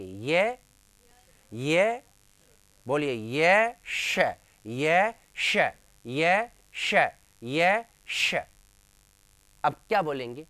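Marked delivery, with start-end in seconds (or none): 0.0-8.3 long ये sweeping upward, then a crisp hushed श, repeated; repeated sound drill. Shown as a demonstration. Teacher repeating five times to place the tongue, drilled ये श. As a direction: chant ये श with rising ये each time. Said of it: "तो आप ये को लेके आएंगे".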